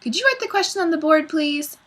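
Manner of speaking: put-on high, quick child-like pupil voice; pupil's polite request. A narrator's reading. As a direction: ask the teacher in a high little voice. Pupil's polite request, later mocked.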